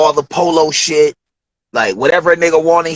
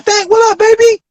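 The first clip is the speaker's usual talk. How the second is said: much higher, louder welcome; hyped greeting; 5 dB louder than usual. Retold or imitated. Studio crew greets the young producer, much higher and excited. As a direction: call out to him like family.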